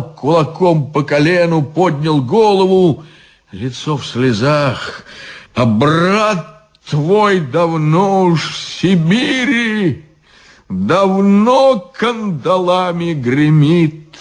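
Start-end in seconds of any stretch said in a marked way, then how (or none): 5.5-14.2 high, slow, anguished repeat of the song line; half-sing it, pained and drawn out; suffering voice. Acted. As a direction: drunk guard echoing the song in anguish. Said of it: "сказал он страдальческим голосом".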